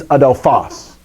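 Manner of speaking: adelphos with PHOS carrying the accent; stress on last syllable. Shown as a demonstration. Teacher modelling how to memorize the noun, adelphos stressed on the last syllable. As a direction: say adelphos as a-del-PHOS, weight at the end. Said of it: "So I have to learn when I memorize".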